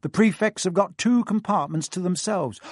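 put-on self-important older brother; pompous prefect. A narrator's reading. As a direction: talk down importantly. Percy the prefect.

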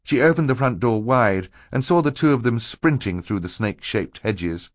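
ordinary delivery

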